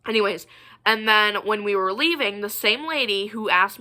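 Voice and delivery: ordinary talk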